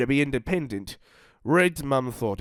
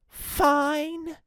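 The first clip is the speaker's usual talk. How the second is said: grumbling. Acted. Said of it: "Red grumbled".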